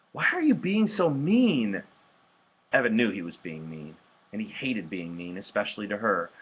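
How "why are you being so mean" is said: high, loud, drawn-out plea; upset protest. A narrator's reading. Sister asking why he is mean, upset. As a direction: wail the question slowly at a brother.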